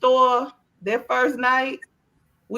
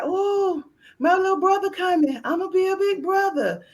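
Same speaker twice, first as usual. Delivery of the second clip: put-on higher, eager little boy; son's excited voice; 10 semitones higher than usual. Mom imitating her son thrilled about the baby, higher. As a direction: bubble over like a proud kid. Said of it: "He was like".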